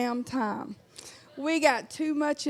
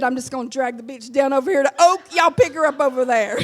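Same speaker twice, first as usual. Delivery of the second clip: ordinary talk, nothing marked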